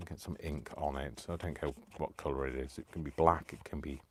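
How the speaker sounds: ordinary talk, nothing marked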